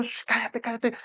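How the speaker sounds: acted crowd trying to silence him; hushing someone. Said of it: "la gente tratando de callarlo".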